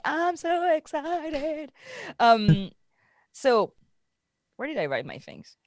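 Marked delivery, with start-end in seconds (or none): 0.1-1.7 high and slow, stretching every word; drawn-out excited. Acted. Host insisting to cohosts she's excited, stretched high. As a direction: sing it out, slow and giddy. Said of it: "You're just so excited".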